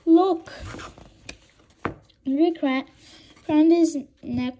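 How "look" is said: cried out. A narrator's reading. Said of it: "Rory cried out".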